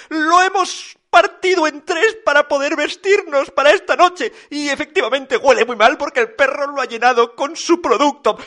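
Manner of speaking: high-pitched piping explanation; matter-of-fact piglets. A narrator's reading. Three piglets explaining the divided suit, high piping voice. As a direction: chirp the whole account up high.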